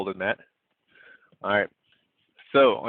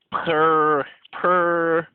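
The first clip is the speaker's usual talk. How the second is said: a louder, higher mock purr; playful rolled cat purr; 6 semitones higher, 4 dB louder than usual. Shown as a demonstration. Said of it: "make your cat noise".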